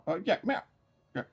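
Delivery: high meow. Real, not acted. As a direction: chirp a meow at the cat. Meowing at the cat.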